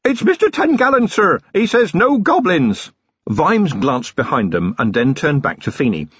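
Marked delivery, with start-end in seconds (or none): 0.0-2.9 high, loud shout over the roar. Acted. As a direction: yell the news across the storm. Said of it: "Vimes heard him shout".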